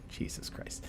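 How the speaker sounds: whispered aside to oneself; very soft, whispered exclamation; under the breath. Real, not acted. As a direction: mutter it almost silently in disbelief. Muttered reaction to the floor count.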